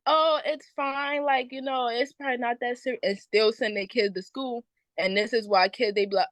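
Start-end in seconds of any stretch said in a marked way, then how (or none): 0.1-3.0 mocking unbothered parent. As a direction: drag it out like it's no big deal. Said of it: "They'll be like".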